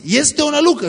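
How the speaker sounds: high, alarmed cry of disciples; frightened disciples. Acted. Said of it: "s-au înspăimântat și au zis".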